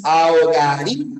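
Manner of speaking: Ahogaría said with the stress on the í; demo pronunciation. Shown as a demonstration. Teacher demonstrating to the class how to say Ahogaría. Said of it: "¿Y la pronunciación cómo es?".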